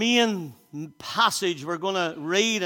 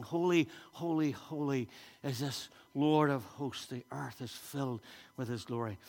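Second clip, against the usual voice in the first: quiet reverence; 4 semitones lower, 7 dB quieter than usual. Acted.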